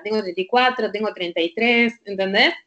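ordinary talk

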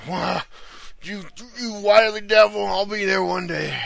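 put-on high, loud crotchety old-timer; old prospector. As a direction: shake a fist like a grumpy old prospector. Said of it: "Just like".

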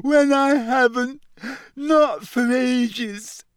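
very high, slow, broken wail; shrill self-pitying ghost. A narrator's reading. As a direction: wail each phrase as if near tears. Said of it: "she said shrilly".

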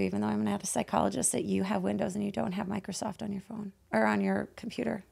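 ordinary talk, nothing marked

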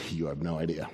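very low, soft voice of God; hushed, low assurance; quiet reply. Acted. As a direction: answer gently from deep down. God gently answers Elijah.

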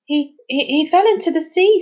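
put-on high, loud stammer of a frightened boy; stammering in shock. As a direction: stumble over the words in panic. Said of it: "stammered Charlie".

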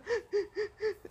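sharp high gasp between sobs; imitated crying gasp; sobbing gasp. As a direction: mimic a sob catching your breath.

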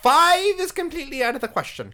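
very high Python counting voice; high-pitched Holy Grail voice. Acted. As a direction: count solemnly in a silly high voice. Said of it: "Their high-pitched voice".

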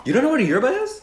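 high incredulous question. Real, not acted.

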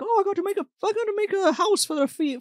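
high-pitched old grandpa impression; mock old man chattering. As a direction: put on an excited grandpa's high voice. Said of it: "and he's like".

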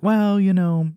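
high, hesitant mock encouragement; trying to be supportive. Friends talking to jobless man, mock supportive. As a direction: pitch it up, imitating lame support. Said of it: "trying to be supportive".

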